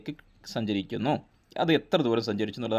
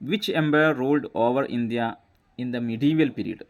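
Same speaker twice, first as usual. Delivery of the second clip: slow dictation reading; much slower than usual. Shown as a demonstration. Teacher reading out quiz question.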